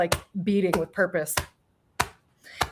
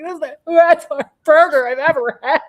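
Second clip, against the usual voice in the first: laughing gush; 9 semitones higher, 10 dB louder than usual. Retold or imitated. Telling girlfriend about the burger.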